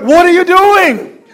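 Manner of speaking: husband demanding what his wife is doing; serious demand. Retold or imitated. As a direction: confront her over the sandwich. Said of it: "Jason's like".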